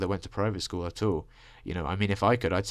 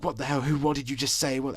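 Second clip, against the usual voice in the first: higher-pitched, indignant retold outburst; stern mock scream; 7 semitones higher than usual. Relatives confronting him over a misheard insult, mock stern outrage. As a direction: imitate a stern telling-off without really screaming. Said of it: "real stern voice looking at me".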